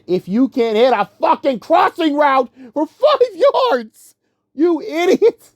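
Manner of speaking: high, strained exasperated outburst; almost screaming. Real, not acted. Fan berating quarterback for missing a five-yard route, almost screaming. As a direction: voice climbs to a near-scream in frustration. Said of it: "I almost was screaming".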